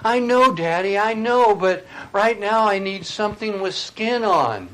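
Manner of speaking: put-on high, loud child's plea; a small boy insisting on what he needs; little boy voice. Little boy answers his father in storm, high loud child voice. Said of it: "the little boy says".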